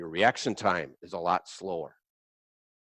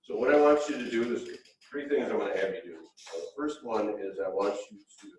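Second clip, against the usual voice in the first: ordinary talk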